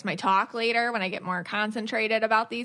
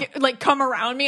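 ordinary talk

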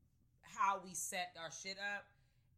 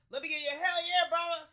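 loud, high rallying call; rally the fans, shout it; hype shout; 12 semitones higher, 17 dB louder than usual. Real, not acted. Calling out to listeners.

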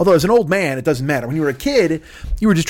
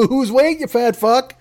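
chairs taunting; 7 semitones higher, slower than usual. Pretend. Chairs taunt repeated, higher.

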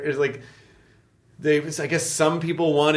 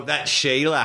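slow imitation Australian accent; Australian twang on "Sheila"; slower than usual. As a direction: copy an Australian girlfriend's vowels. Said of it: "I can't do an Australian accent".

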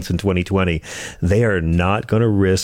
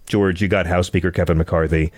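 usual speaking voice

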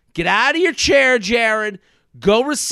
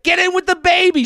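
eager command; 9 semitones higher than usual. Retold or imitated. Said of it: "She's like".

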